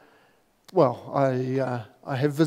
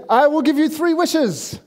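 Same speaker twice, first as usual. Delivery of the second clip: higher, booming genie proclamation; grand genie; 8 semitones higher than usual. Acted. Pastor voicing the genie in his joke, grandly. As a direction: announce the wishes like a magic genie.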